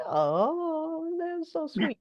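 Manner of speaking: high, soft cooing; cooing at a toy. Retold or imitated. Talking to her stuffed animal, high and soft cooing. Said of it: "and I'm like".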